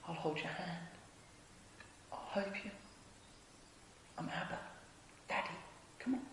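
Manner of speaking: very hushed, gentle reassurance; tender fatherly comfort. Acted. God coming down small to comfort, hushed and tender. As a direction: murmur it softly, with long pauses.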